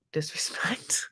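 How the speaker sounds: whispered mock accusation; husband's retort whispered for effect; whispered punchline. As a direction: drop to a whisper on the last word. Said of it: "And he's like".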